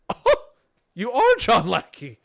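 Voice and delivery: suddenly realising who he is; a burst of delighted surprise; starstruck surprise. Retold or imitated. Meeting a famous player, starstruck.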